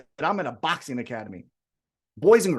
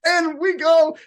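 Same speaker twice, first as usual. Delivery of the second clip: laughing; 13 semitones higher, 7 dB louder, slower than usual. Real, not acted. Laughing while retelling.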